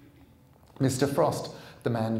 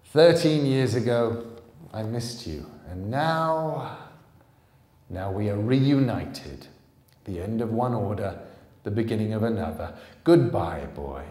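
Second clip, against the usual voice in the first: louder, menacing farewell; menacing villain; 7 dB louder than usual. Acted. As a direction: threaten him coldly, then finish. Jack menacing before the kill.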